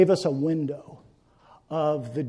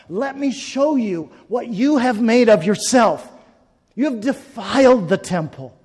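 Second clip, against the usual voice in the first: loud, raised voice of God accusing his people; God confronting sinners; 5 semitones higher, 9 dB louder than usual. Acted. God speaking to every human being, loud and accusing. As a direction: thunder it as God holding up a mirror.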